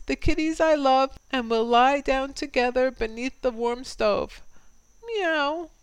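high, fond cat voice with rising meows; a doting mother cat cooing over her kittens; old gray cat. Acted. Old gray cat cooing to her kittens in a high purring voice. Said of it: "I heard her whispering soft".

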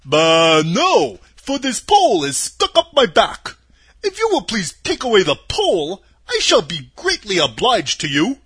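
pleading, husky scarecrow. Acted. Scarecrow explains.

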